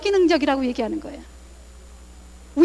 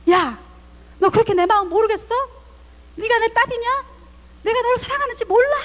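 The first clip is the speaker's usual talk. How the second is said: mock exasperated mother, pitch climbing higher; frowning mother; 5 semitones higher than usual. Mother frowning at her daughter, exasperated scolding. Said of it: "인상을 쓰시면서".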